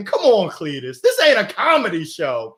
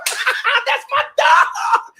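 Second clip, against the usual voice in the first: delighted shout; much slower than usual. Real, not acted. Son brings food during live.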